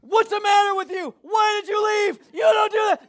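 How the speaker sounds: high, loud mock tirade; imitated furious scolding. Scared mom lights into her found son, imitated furious scolding. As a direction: tear into the child, hammed-up. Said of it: "she lit into Zachary hard".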